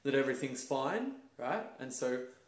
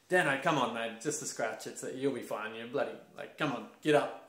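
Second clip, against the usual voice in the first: louder brush-off from a mate; older boys telling an injured kid to get up; dismissive bloke; 7 dB louder than usual. Retold or imitated. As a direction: wave it off like it's nothing. Mates dismissing his injury.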